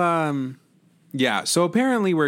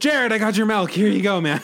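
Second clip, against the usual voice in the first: excited; 10 semitones higher, 5 dB louder than usual. Acted. Calling with the milk.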